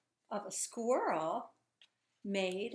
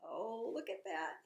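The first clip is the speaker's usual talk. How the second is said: ordinary delivery, nothing marked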